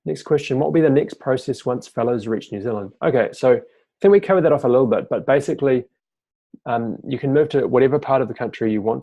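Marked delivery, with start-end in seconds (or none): none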